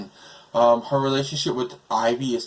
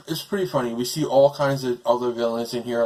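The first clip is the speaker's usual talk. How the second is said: usual speaking voice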